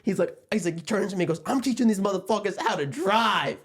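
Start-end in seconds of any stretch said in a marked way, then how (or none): 1.5-3.6 high, worked-up road-rage boast; imitated driver's rant. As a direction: act out a fired-up driver. Driver rants mid road rage.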